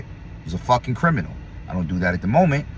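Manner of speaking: ordinary talk, nothing marked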